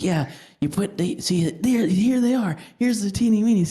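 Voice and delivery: put-on lightly done country bumpkin accent, rising. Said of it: "wicked country bumpkin accent".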